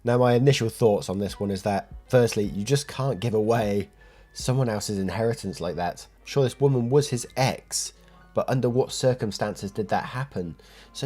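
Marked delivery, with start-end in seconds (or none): none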